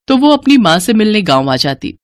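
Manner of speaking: ordinary delivery, nothing marked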